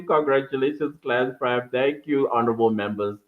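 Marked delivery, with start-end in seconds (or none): none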